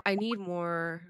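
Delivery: usual speaking voice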